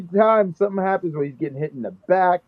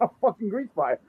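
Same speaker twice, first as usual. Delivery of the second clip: usual speaking voice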